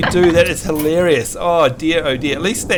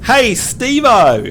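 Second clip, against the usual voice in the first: drawn-out, higher Aussie call; Australian accent demo; 4 semitones higher, much slower than usual. Shown as a demonstration. Host models an Aussie greeting for repeating, Australian accent. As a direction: call it slowly in broad Australian. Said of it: "repeat after me".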